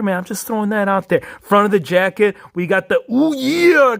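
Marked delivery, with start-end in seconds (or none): 3.1-4.0 higher, louder wrestler yeah; hyped wrestler call; Macho Man catchphrase. Retold or imitated. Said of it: "how many times does Hero Fig say, "Ooh, yeah".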